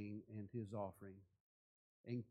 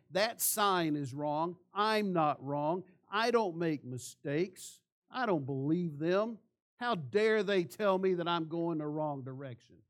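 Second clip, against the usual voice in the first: loud, higher-pitched mock indignation; indignant denial; 3 semitones higher, 6 dB louder than usual. Driver refusing to believe the sign, indignant denial. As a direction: protest firmly, leaning on each claim.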